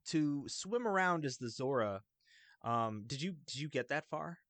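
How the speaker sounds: ordinary talk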